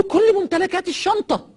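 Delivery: clutch at the bag in a panicked high voice; frantic dying man. Retold or imitated. Dying businessman on the plane, panicked high cry for his bag.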